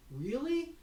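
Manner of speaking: high, rising mock-surprised question; re-enacted surprised reaction; delighted surprise. Retold surprised reply to hairdresser.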